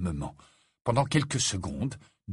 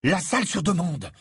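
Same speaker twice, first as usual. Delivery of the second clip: higher-pitched cry of discovery; exclaiming; 6 semitones higher than usual. A narrator's reading. Harry striking his forehead on realising, exclaiming. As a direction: burst out with the sudden realisation. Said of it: "s'exclama Harry".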